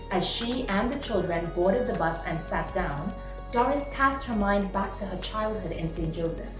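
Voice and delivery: usual speaking voice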